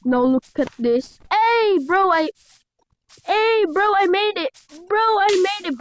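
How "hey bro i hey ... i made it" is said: high loud cheering; triumphant yelling. Real, not acted. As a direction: call out the win. Clears level, shouts triumph.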